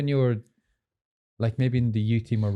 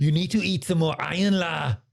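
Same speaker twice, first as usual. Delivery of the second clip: put-on higher Scouse-style Tommy Gravison accent, a bit Arnie; 5 semitones higher than usual. Footballer doing his old teammate's accent on request, Scouse impression. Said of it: "Go and try his accent".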